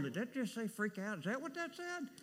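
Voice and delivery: a scandalised whisper-like double take, high; mock shocked churchgoer. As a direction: pitch rising high on the second question. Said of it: "some of y'all were sitting there going".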